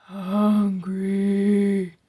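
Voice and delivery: zombie croak. A narrator's reading. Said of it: "Charlie Zombie croaked".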